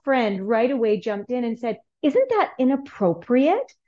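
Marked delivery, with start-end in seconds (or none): 2.0-3.7 imitating a scandalized child, high and slow; slow, drawn-out question rising in disbelief; mildly shocked little-kid voice; mock disapproval. Voicing child friend's disapproval.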